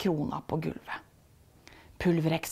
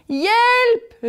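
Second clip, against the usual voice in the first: high, loud panicked yell; calling for help; 8 dB louder than usual. A narrator's reading. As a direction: shout for help while fleeing. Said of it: "roper Pokus".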